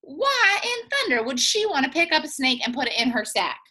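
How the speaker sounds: usual speaking voice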